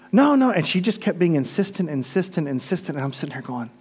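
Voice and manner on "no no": put-on high, loud childish insistence; a stubborn little girl's no; imitating a girl's higher, louder protest; girl insisting. Girl insisting against him.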